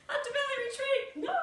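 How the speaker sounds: fake-sob over the cancelled retreat; mock wail. Missing the retreat, mock lament.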